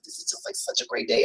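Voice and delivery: whispered, all breath and no voice; breathless whisper. Retold or imitated. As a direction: whisper it like you can hardly believe your luck. Overjoyed self-talk on a great day.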